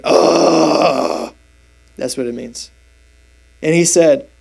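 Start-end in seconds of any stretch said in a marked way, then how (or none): 0.0-1.3 exasperated groan. Retold or imitated. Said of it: "he sighed deeply in his spirit".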